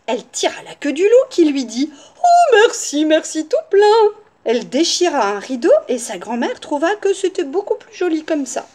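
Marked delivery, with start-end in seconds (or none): 2.2-4.1 high, loud, effusive wolf; exaggerated rapture, pitched way up; gushing thanks. A narrator's reading. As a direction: thank with over-the-top delight. Wolf thanks his tormentor gushingly.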